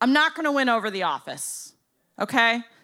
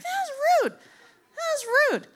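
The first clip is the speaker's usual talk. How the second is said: indignant. Retold or imitated. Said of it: "That was rude, right?".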